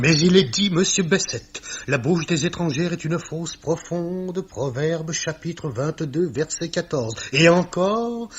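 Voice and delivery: loud and high, hurling the scripture back; indignant outburst. Acted. Clerk Millet rebukes Bessette's foreign fiancée, loud indignant outburst. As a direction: blast the verse at him in outrage.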